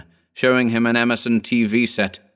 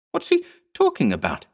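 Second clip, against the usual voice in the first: quick, higher-pitched, uneasy; nervous question; 7 semitones higher, faster than usual. A narrator's reading. Margaret asking about Ed's outburst, nervous. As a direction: ask it fast and nervously. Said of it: "Margaret asked nervously".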